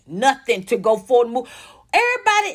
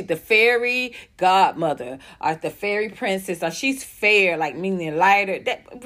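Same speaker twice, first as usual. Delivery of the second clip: usual speaking voice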